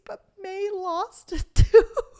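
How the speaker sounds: high, slow, laughing through the words; real laugh while announcing the lost tooth; laughing disbelief. Real, not acted. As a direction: giggle at how absurd it is. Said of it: "I was so shook".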